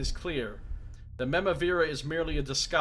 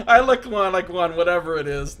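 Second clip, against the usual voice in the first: laughing; 4 semitones higher, 10 dB louder than usual. Real, not acted. Laughing aside.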